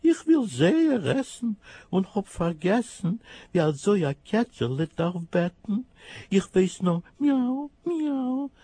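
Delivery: high kitten voice ending in mews; a small hungry kitten pleading; high plaintive kitten. Acted. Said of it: "macht das Kätzele".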